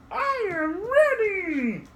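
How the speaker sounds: put-on very high, slow kid puppet. Host voices puppet for the kids, very high and slow. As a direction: squeak it out like an eager puppet.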